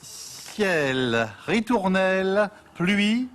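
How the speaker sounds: slow, sung-out poetry reading; poem recital. Acted. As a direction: recite it slowly like verse.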